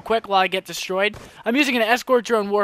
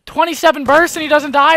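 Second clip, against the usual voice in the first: loud, high protest; incredulous outburst; 9 semitones higher, 9 dB louder than usual. Real, not acted. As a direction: complain loudly in utter disbelief. Enemy survives his fire.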